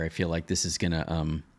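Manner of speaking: ordinary talk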